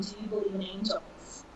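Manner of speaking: whispered. Retold or imitated. Wife's eerie question in the car.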